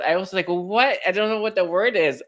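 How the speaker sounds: giddy afterglow of remembering; excited rush. Real, not acted. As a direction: spill it out still thrilled. Excited after finding word.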